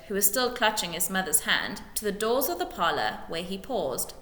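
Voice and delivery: plain reading voice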